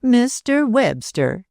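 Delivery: slow, high mocking sneer on Mister Webster; aunt mocking the niece's formal name, sneering. A narrator's reading. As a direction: drag out Mister Webster with contempt. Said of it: "sneered the other".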